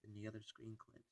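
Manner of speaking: ordinary delivery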